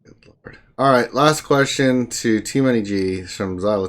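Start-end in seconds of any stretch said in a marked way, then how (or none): 0.0-0.6 whispered. Real, not acted. Exasperated reaction to odd names.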